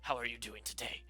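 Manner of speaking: whispered low villain taunt. Pretend. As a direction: hiss it like a lurking killer. Whispered taunt before kill.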